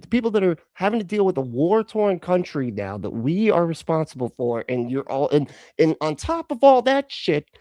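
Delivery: real outrage, yelling at the screen; angry yelling. Real, not acted. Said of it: "Sorry, I didn't mean to yell".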